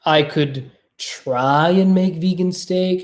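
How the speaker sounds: ordinary delivery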